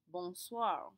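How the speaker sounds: Bonsoir with nasal bon and wa in soir; French bonsoir. Shown as a demonstration. Teacher modeling bonsoir for class. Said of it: "switch out the word day".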